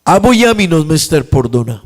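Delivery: high, ringing preacher's voice; preaching. Acted. Pastor urging the congregation on forgiveness, fervent. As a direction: lift it up and preach.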